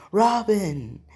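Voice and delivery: high, loud swooning cry; smitten calling out. Acted. Sanji greets his darling Robin, smitten calling out. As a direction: call a sweetheart's name with showy delight.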